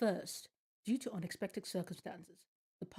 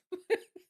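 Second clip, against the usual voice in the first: sharp high laugh of surprise; a burst of shocked laughter; startled laugh; 11 semitones higher, 4 dB louder than usual. Real, not acted. Startled by a sudden jump scare.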